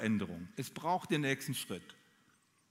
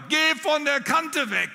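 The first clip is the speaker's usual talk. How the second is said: much higher, loud, slow shout; shouting mother; 11 semitones higher, 9 dB louder, slower than usual. Retold or imitated. Dead mother shouting in her ear at the edge, high and slow. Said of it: "Die rief mir ins Ohr".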